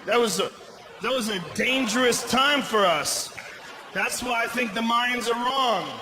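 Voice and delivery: normal talking voice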